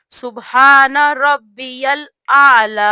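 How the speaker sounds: سبحان ربی الاعلی slowly with the full doubled b in ربی; slow correct pronunciation. Shown as a demonstration. Teacher giving the correct word, slow and clear. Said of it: "صحیح لفظ کیا ہے".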